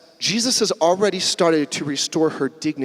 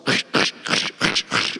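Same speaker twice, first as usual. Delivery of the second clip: rhythmic swishing windbreaker noise; fast-walking noise; 5 semitones lower than usual. Acted. Preacher imitating an old lady walking the mall, brisk swishing. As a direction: imitate quick swishing strides with the mouth. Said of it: "like walking really fast".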